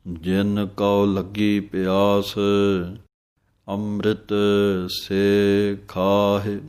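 measured, drawn-out recitation of gurbani; slow devotional recitation; slow scripture recitation. Shown as a demonstration. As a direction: recite each word slowly and clearly. Recites scripture line slowly.

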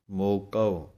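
ਮੋਕਉ with a clear final kau, not moko; correct pronunciation. Shown as a demonstration. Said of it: "ਆਵਾਜ਼ ਆਉਣੀ ਹੈ ਕਉ".

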